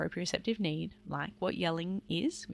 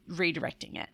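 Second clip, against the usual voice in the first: normal talking voice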